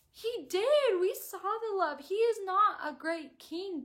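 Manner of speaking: high, loud pleading insistence; passionate protest. Retold or imitated. Reader arguing with Maven, high loud insistence. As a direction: argue back at the book, worked up. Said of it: "And I was like".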